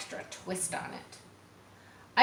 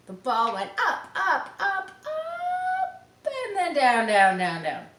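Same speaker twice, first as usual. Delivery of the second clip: rising and falling voice; 10 semitones higher, 6 dB louder, slower than usual. Acted. Reading the ball's flight to children, pitch rising then falling. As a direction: pitch climbing high on up, up, sliding down on down.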